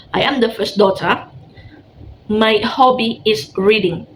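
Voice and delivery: slow, clear teacher model; slow model reading. Shown as a demonstration. Teacher models a line for the class to repeat, slowly. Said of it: "repeat after me".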